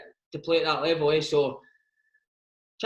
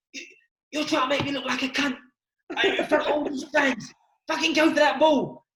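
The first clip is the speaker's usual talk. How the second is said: raised, rising mock rant at a teammate; angry teammate; 6 semitones higher than usual. Teammate ranting over a misplaced pass, hammed-up anger. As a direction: tear into Pat for the bad pass. Acted footballer row.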